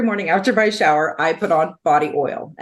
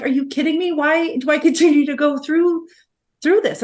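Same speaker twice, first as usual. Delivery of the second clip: pitched up, incredulous; exasperated disbelief; 9 semitones higher than usual. Retold or imitated. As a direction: throw up your hands, can't believe it's happening again. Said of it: "And I'm like".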